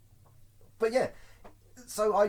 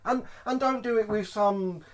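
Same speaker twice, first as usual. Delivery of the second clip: ordinary talk, nothing marked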